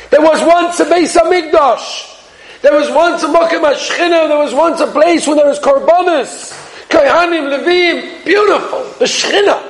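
high-pitched, emotional retelling; impassioned emotion. Retold or imitated. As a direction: pour your heart into the retold lament. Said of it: "he gave it over with such emotion".

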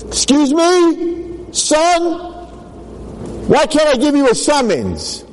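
high, incredulous trooper outburst; hammed-up indignant cry, far above normal pitch; mock outrage. Trooper's outraged reply to driver.

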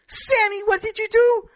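high, loud Yiddish mother shriek; yiddish accent. Acted. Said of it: "his mother said".

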